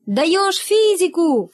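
high, drawn-out rallying cry; high-pitched collective cheer; class crying out. A narrator's reading. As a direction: stretch it out like pupils chanting a slogan. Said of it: "вскричал класс".

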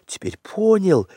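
put-on very high boy's voice; excited young Mowgli. Mowgli working out the broken twigs, excited and high. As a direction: puzzle it out, then burst into high, eager discovery.

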